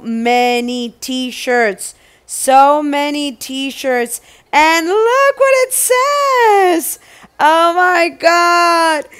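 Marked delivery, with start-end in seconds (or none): 4.5-9.1 delighted squeal. Real, not acted. Unboxing for viewers, delighted high squeal. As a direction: squeal with delight at what it says.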